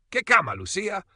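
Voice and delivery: alarmed, urgent asking; startled question. A narrator's reading. Grandfather questions little granddaughter about the bed, startled. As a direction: pitch leaps high.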